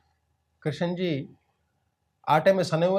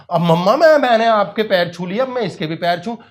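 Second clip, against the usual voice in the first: put-on louder whining boy; loud protest; 3 semitones higher, 8 dB louder than usual. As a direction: push back hard at mom. Child protests loudly.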